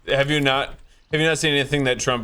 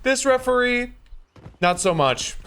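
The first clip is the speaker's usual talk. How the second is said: lightly voiced Trump, raised pitch, half laughing; Trump impression; 11 semitones higher than usual. Mocking Trump judging a referee, light Trump voice. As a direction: dismiss the referee like Trump would. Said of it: "the top tier Trump, like, voice there".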